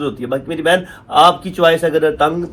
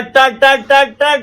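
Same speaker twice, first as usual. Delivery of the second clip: quick loud rattling tur tur; playful imitation of rapid talking; chattering sound; 8 semitones higher, 5 dB louder, much slower than usual. Shown as a demonstration. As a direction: mimic nonstop chatter, high and louder. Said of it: "आदत बन चुकी है टर".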